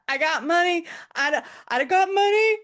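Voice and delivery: high, loud, desperate mock plea; Pretty Woman impression. Imitating the Pretty Woman heroine at reception, hammed-up. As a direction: plead like a frantic film heroine. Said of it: "like a scene out of Pretty Woman".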